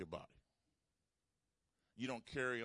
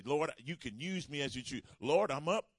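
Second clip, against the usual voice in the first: loud, higher, ringing prayer; preacher leading the crowd in a shouted vow; fervent prayer; 4 semitones higher, 10 dB louder than usual. Acted. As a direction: call it out to God with everything.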